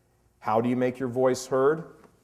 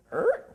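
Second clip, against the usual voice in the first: a squeaky questioning "Er?" high up like a confused dog; puzzled dog noise. Retold or imitated. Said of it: "like, you know, the doggy who".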